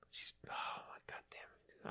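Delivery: very soft whispered curse of disbelief; awed whisper. Retold or imitated. Guy raving to friend about her, whispering. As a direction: breathe it out, almost no voice.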